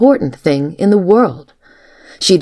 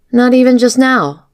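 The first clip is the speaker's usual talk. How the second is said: higher, slightly hard question; touch of hardness; 9 semitones higher, 3 dB louder than usual. A narrator's reading. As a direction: challenge him with a touch of hardness. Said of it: "with a touch of hardness".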